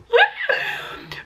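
sudden high-pitched laugh. Real, not acted. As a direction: burst out laughing in disbelief. Cringing at the fanfic.

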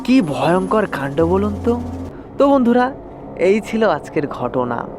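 usual speaking voice

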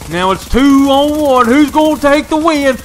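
announcer-style excitement; hype call. Real, not acted. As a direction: pump up the clutch moment. Hyping the final fight.

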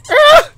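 high sharp scream of fright; startled scream. Real, not acted. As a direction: shriek at the jump scare. Sudden jump scare.

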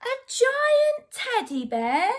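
slow, high, loud storybook astonishment; amazed. A narrator's reading. Boy discovers a giant teddy, slow amazement. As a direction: stretch it out in wide-eyed wonder.

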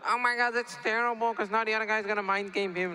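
high-pitched mock panic voice; panicking online viewer. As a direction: whine like a viewer losing their mind. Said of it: "losing their mind".

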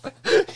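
shrieking laugh; a loud, high cackle; high burst of laughter. Real, not acted. Telling a friend the trash story, cracking up loud.

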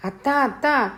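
high-pitched call for an aunt. A narrator's reading. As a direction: call indoors to tell on him. Runs indoors calling her aunt. (అత్తను పిలుస్తూ)

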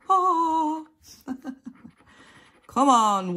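normal talking voice

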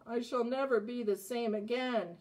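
ordinary delivery, nothing marked

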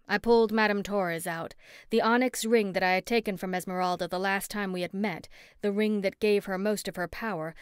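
plain reading voice

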